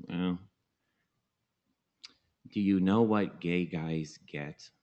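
slow, put-on voice of a hesitant friend; Jerry Lewis. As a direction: imitate the friend, drawing out the question. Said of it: "Jerry Lewis kind of a thing".

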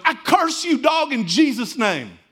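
loud, high, then slow commanding curse. Retold or imitated. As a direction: pronounce the curse with full authority. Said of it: "and he goes".

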